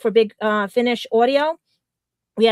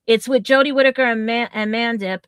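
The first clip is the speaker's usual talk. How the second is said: normal talking voice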